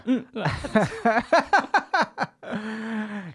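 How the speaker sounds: high, bright burst of laughter. Real, not acted. Laughing at the kick.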